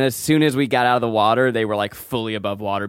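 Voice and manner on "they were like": usual speaking voice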